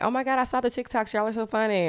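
high-pitched, put-on gushing; fake friendliness. Ex-friend pretending to like the TikToks, fake gushing. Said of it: "she'll try to pretend like".

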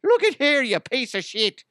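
high, loud cereal-mascot Irish; cartoon Irish accent. Owner ordering the dog about in a cartoon Irish accent. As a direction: ham up a high-pitched stage-Irish voice. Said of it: "being reminded anything of anything other than Lucky".

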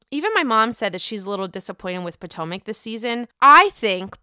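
ordinary delivery

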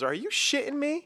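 high squealing disbelief; shocked disbelief. Retold or imitated. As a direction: imitate her stunned, high-pitched shock. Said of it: "and she goes".

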